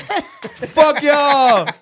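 high, loud comic retort; mock-offended outburst. Real, not acted. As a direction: throw it back at your teasing friends. Teased host snaps back jokingly.

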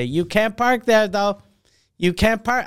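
imitating the old lady on her balcony; mimicking old lady. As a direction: repeat it like a nagging neighbour. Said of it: "I parked here yesterday".

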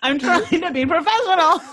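high, laughing through the words; cracking up while trying to be serious; trying not to laugh. Real, not acted. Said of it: "There's just lots of laughing happening".

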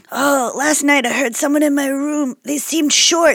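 soft, coy murder-mystery hinting; hushed mock witness; playacted clue-dropper. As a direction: drop a clue quietly, feigning innocence. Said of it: "I would be doing clues like".

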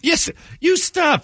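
playful scolding; mock indignant. Real, not acted. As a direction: cut them off in fake outrage. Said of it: "mock outrage banter".

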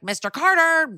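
imitated singsong call through a door, high. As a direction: call for someone behind a closed door. Super knocks, calls through the door.

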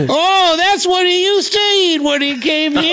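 high, slow mock holler; screaming deaf neighbor. Guest joining in as the deaf neighbor, high mock holler. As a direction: copy the deaf man's screamed voice, drawn out. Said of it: "he just screams from all that tractor work".

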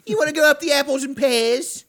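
loud, high-pitched Cockney shout; Cockney accent. Acted. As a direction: holler it like a hammed-up Cockney. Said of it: "I wanted to give him a Cockney accent".

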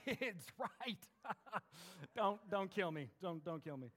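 awkward joking aside with a laugh; nervous parent. Acted. Embarrassed parent to the man in line, nervously joking. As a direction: laugh it off, pleading lightly.

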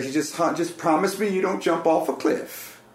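put-on cooing old woman. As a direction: coo it like a kindly grandmother. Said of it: "she had, like, an accent".

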